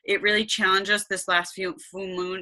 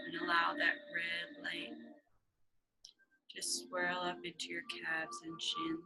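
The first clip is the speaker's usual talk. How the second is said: hushed, calm, half-whispered guidance; guided meditation; 9 dB quieter than usual. Acted. Guided meditation over music.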